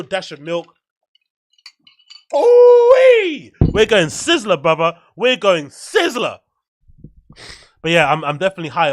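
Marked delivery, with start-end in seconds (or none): 2.3-3.5 high, loud, hooting cry of delight; excited whoop. Real, not acted. Hype about the drink. 3.6-6.4 hyped. Real, not acted. Hyped about the drink.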